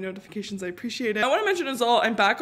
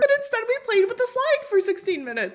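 laughing; 10 semitones higher, 4 dB louder than usual. Real, not acted. Laughing about slide.

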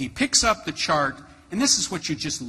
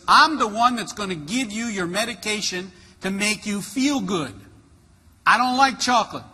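higher, louder mock-cross voice; unhappy nurse; 5 semitones higher, 4 dB louder than usual. Nurse telling the patient who gives the medication, crossly. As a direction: lay down the law as a grumpy nurse. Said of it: "You don't seem very happy".

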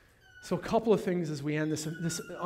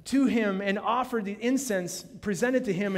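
loud, high scripture reading, hammered home; fiery pulpit reading; preaching at full force; 7 semitones higher, 5 dB louder than usual. Acted. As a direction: proclaim it to the whole hall.

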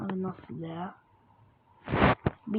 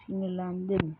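ordinary talk, nothing marked

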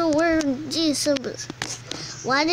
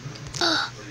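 sharp intake of breath; shocked gasp; 7 dB quieter than usual. Acted. Shocked reaction before exclaiming.

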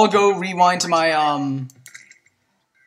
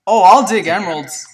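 ordinary talk

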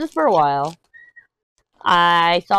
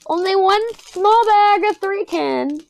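high, slow, whiny mock pout; 12 semitones higher, 4 dB louder, slower than usual. Real, not acted. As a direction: pout over the tiny bag. Mock sad over tiny bag.

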